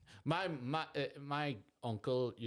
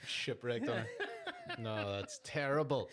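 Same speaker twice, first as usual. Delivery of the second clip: laughing groan; 3 dB quieter than usual. Real, not acted.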